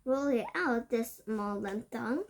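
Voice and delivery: plain reading voice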